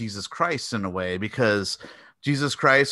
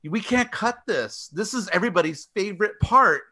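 higher-pitched, insistent objection; pleading protest; 8 semitones higher than usual. Retold or imitated. Filmmakers arguing with the ratings board, pleading protest. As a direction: plead the case as if to a censor. Acting filmmakers' plea to the MPAA.

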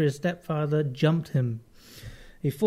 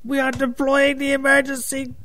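mayday radio call; 9 semitones higher, 3 dB louder than usual. Pretend. Continues mock distress call.

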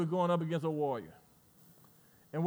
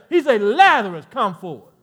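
louder, calling out Lazarus; a commanding preacher's call; preaching at full force; 10 dB louder than usual. Acted. Preacher voices Jesus calling Lazarus, loud commanding preaching.

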